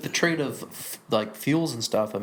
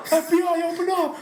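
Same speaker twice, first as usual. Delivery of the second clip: high, loud raid yell; mock police shout; 4 dB louder than usual. Cohost pretending FBI agents raid the studio, loud high yell. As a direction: bang on the door, shout it.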